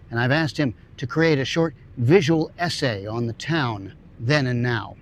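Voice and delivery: ordinary talk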